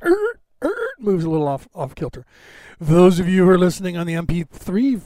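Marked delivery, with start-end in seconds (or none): 0.0-0.9 high squeaky creaking noise, like a bumped box. Retold or imitated. Bumped camera box wobbling, high squeaky creak imitation. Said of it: "and it goes".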